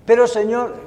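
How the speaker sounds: pleading interruption; mock protest. Protesting to God again.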